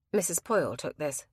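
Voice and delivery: ordinary delivery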